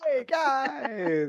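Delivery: high, drawn-out happy call; cheery, happy greeting. Acted. Player echoing Nova's greeting to the group, cheery. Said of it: "He's all cheery and happy".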